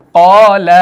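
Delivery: loud, drawn-out قال, heavy qaa and light laa; full qaa, fine laa in قال; thick then thin alif. Shown as a demonstration. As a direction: recite قال firmly, rounding the first aa. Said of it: "اس الف کو موٹا پڑھیں گے".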